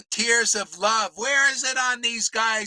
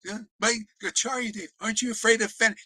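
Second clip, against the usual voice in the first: ordinary talk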